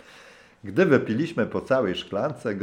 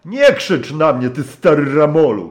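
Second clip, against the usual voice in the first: angry shout; 7 semitones higher, 10 dB louder than usual. Acted. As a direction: lose your temper and shout him down. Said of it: "krzyknąłem". (gniewny, głośny krzyk)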